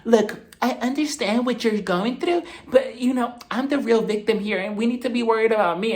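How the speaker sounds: high, put-on voice of reason; Dorit calming down. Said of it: "she eventually calms down and she goes up to Crystal".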